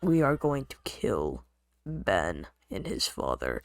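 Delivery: low, slow, ominous threat; slow creepy kid threat; menacing kid quote. Retold or imitated. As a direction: drag out a deadpan death threat.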